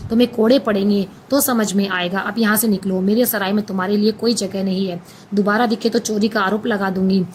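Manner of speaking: ordinary delivery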